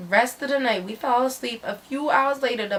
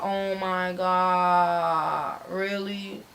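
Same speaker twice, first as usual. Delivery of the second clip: slow, low, drawn-out mock groan; weary retold disbelief; exasperated; 4 semitones lower, 5 dB quieter, much slower than usual. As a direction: drag it out, fed up with the tears. Exasperated reaction to friend's sobbing.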